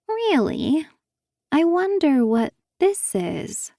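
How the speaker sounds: slow, sing-song mockery; taunting. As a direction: tease her, savouring it. Emily taunting with the receipt.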